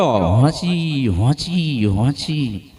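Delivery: slow drawn-out hee-haw bray; donkey bray. Retold or imitated. Preacher mimics the donkey's bray for the crowd, a slow braying. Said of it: "ગધાડો ભૂંક્યો".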